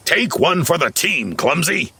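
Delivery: high-pitched call from afar. A narrator's reading. Grouchy watches Clumsy get chased, calling out. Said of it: "Grouchy called out".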